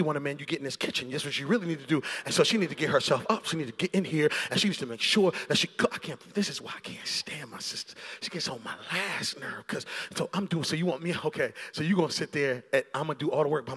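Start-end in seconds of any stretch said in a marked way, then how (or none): none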